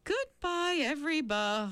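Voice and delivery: high yawning farewell, trailing off; falling asleep. A narrator's reading. The medium saying goodbye as she dozes off, sleepy. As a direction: let the last word dissolve into sleep. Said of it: "her word got lost in the general bzzz of a snore".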